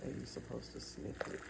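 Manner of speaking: ordinary talk, nothing marked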